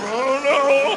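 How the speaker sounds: high, meek shrug of a reply; mock unsure boyfriend. Audience boyfriend admitting doubt, high meek voice. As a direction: play a clueless guy caught out.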